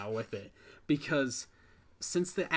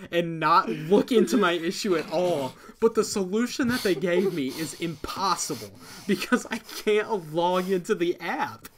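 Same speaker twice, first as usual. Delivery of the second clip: loud, higher-pitched frustrated venting; really worked up, pushing louder and higher; heated rant; 4 semitones higher, 7 dB louder than usual. Real, not acted. Co-host rants about a broken movie app, heated. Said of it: "I'm getting really heated about this".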